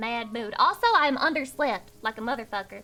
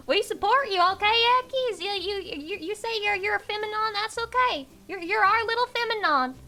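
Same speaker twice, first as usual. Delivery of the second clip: high, coddling voice; teasing reassurance; 7 semitones higher than usual. Real, not acted. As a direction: coo support at them in a raised pitch.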